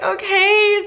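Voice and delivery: high, whiny, drawn-out consent; mock tearful okay. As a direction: imitate agreeing through tears. Tearful reply to frantic mom.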